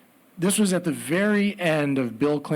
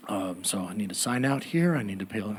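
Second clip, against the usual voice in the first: low, soft, ordinary-guy voice; low-key businesslike murmur; 7 semitones lower, 6 dB quieter than usual. Retold or imitated. Man signing out with the security guard, low-key murmur. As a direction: keep it low and unassuming.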